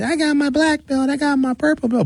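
put-on high, boastful kid voice; bragging little kid. Comedian mimicking karate kids bragging, high childlike. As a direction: brag like a proud seven-year-old. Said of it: "little kids that be in karate".

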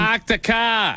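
put-on high, drawn-out Boston accent; mock Boston accent. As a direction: crow it slowly in mock Boston. Joins in mocking Boston accent.

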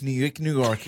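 ordinary delivery

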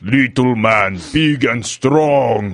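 put-on slow, booming Russian accent, a proud papa; drawn-out Russian accent, puffed-up pride; big Russian accent. [name] to his co-host, a proud Russian papa in a slow booming Russian accent. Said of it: "gotta say it in a big Russian accent".

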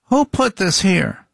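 high, slow, accusing wife voice; nagging wife. Retold or imitated. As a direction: mimic a wife nagging from the first word. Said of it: "But instead it starts with".

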